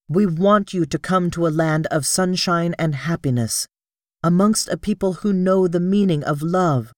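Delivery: high, earnest persuasion; pleading princess. Acted. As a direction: win Sola over. Princess begs Sola to come.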